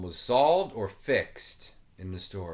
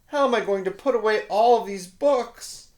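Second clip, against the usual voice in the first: dismayed volunteer; 11 semitones higher, 7 dB louder than usual. Acted. Volunteer despairs at mess.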